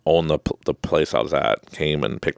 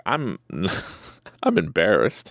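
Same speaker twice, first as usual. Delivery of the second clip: ordinary delivery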